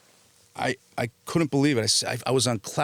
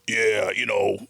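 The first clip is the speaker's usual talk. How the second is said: rough put-on tough-guy mumble; Vinny Pastore; slower than usual. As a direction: imitate a familiar colleague chatting offhand. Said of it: "I hear a familiar voice".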